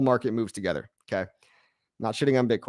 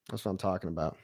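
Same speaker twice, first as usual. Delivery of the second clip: quiet echo; 6 semitones lower, 11 dB quieter, faster than usual. Real, not acted. Repeats line, much quieter.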